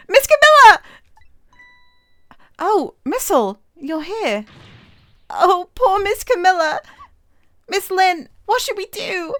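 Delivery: a yappy little dog calling for his friend; high excited puppy voice; eager little dog Missile. Acted.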